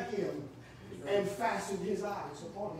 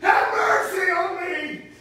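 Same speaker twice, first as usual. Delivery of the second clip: slow, shouted, much higher cry for mercy; yelled plea; 12 semitones higher, 15 dB louder, slower than usual. Retold or imitated. Blind man crying out to Jesus, slow desperate shout. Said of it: "that blind man yelled out".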